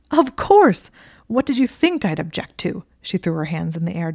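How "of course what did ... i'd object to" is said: high, loud, despairing cry; exasperated outburst. Acted. Hermione exasperated at Draco, despairing outburst. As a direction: throw up your hands in the voice.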